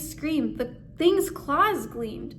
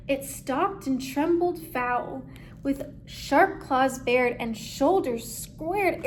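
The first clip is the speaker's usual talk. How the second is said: usual speaking voice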